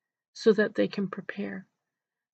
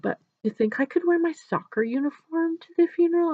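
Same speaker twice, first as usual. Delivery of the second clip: put-on hopeful little boy, pitched higher; boy's voice; 8 semitones higher, 3 dB louder than usual. Boy asking nurse about wearing soccer uniform, higher hopeful voice. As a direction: ask it like an eager six-year-old. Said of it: "He said".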